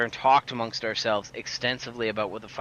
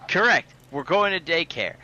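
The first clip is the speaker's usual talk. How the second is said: higher and louder, slow and insistent; mock outburst, pitched up and loud; retold exasperated shout; 5 semitones higher, 5 dB louder, slower than usual. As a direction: bark it back at her, fed up. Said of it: "I'm like".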